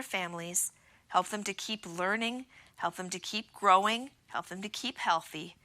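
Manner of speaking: quiet, earnest prayer voice; teacher praying for her students. Acted. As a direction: pray softly over the children. Closing prayer for the class.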